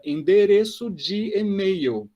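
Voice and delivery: "endereço de e-mail" drawn out syllable by syllable; teacher stretches the Portuguese phrase to show how long it is, slowly articulated. Shown as a demonstration. Teacher shows a many-part phrase, slowly articulated. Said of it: "ela é articulada".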